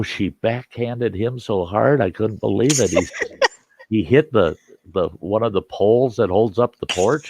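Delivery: usual speaking voice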